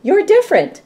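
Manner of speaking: cheerful exclaiming; excited. Acted. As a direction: celebrate it to the kids. Exclaimed line in read-aloud.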